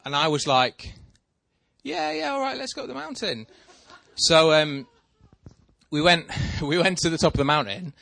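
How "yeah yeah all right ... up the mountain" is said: very high, small squeaky voice; timid agreement. Retold or imitated. Nervous beginner agreeing to go up the mountain, timid agreement. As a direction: agree in a tiny, high, softened voice. Retold reluctant agreement to ski.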